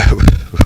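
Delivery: quick breathy laugh; a brief real chuckle; short laugh. Real, not acted. Storyteller laughing at his own punchline, short chuckle.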